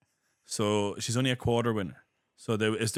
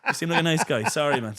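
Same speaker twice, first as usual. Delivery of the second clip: higher, louder comic backing down; hammed-up sheepish apology, half laughing; mock apologetic attacker; 6 semitones higher, 5 dB louder than usual. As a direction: play a madman suddenly won over.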